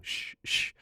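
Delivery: hushing. Retold or imitated. Mom hushing while eavesdropping.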